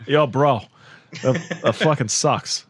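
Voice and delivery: slow, drawn-out Boston bro; Boston accent. Pretend. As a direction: lay on a thick Boston accent. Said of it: "your in point to a Boston accent".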